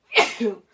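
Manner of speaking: loud real sneeze; one sudden loud sneeze; sneezing. Real, not acted. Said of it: "I'm gonna sneeze".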